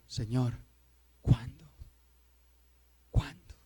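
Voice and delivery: low, soft cry to the Lord; quiet, weary longing in prayer; hushed tearful plea. Acted. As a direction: ask it almost under the breath, with long pauses. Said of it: "Deberíamos estar clamando y llorando".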